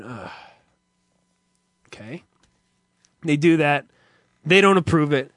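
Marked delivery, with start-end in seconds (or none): 0.0-2.2 deflated sigh. Retold or imitated. Deflated thought.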